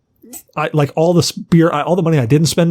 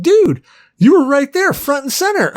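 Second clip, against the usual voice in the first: much higher, eager dude; singer's voice; 12 semitones higher than usual. Retold or imitated. Singer tells fan he was front and center, higher hyped voice. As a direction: hype the fan up. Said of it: "He's like".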